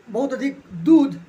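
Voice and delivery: "दूध" said slow, loud and drawn out; slow emphatic list. Real, not acted. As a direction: lean hard on "बहुत अधिक दूध", slowly. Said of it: "emphatic listing". ("दूध" पर धीमा और ज़ोरदार ज़ोर)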